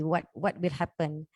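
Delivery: usual speaking voice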